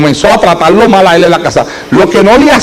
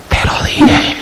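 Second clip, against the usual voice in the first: soft unvoiced whisper, close to the ear; whisper in her ear; 6 dB quieter, much slower than usual. Pastor whispering into the wife's ear, hushed whisper. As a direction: breathe it quietly into her ear. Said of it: "me le pegué al oído y le hablé".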